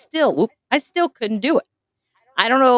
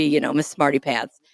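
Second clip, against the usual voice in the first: ordinary talk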